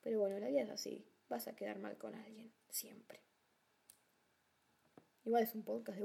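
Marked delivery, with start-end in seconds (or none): 1.3-3.2 whispered resigned aside; whispering. Real, not acted. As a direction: whisper it like a secret.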